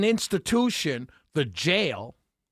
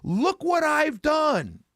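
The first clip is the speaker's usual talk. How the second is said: high, slow mocking shout of a boastful official; mock politician yell; 11 semitones higher, much slower than usual. Officials in the streets boasting to voters, a slow mocking yell. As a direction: bray it out like a politician taking credit. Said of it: "and yell".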